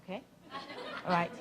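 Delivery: ordinary talk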